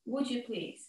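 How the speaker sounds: polite request. Shown as a demonstration. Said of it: "Clear request".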